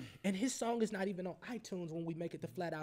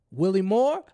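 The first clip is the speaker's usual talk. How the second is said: loud, raised imitation of the President; booming presidential greeting; 4 semitones higher, 15 dB louder than usual. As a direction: boom out the name like an official greeting. Imitates President greeting him on line.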